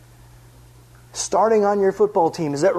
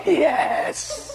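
gleeful yes; 10 semitones higher than usual. Retold or imitated. Silent triumph each bite.

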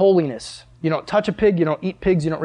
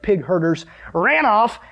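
ordinary talk, nothing marked

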